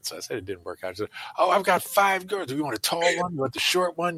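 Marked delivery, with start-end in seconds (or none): 1.4-4.2 pushy matchmaker mother. Retold or imitated. Mother offering her five daughters, high mock matchmaker voice. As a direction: pitch it up high, offering one after another.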